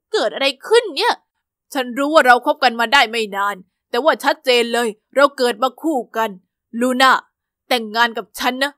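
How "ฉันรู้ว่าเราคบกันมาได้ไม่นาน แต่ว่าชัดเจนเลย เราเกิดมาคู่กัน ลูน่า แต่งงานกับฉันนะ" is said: lower-voiced eager young suitor; earnest boyfriend proposing. Boyfriend kneeling on the platform, lower eager proposal. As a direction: propose with big earnest hope.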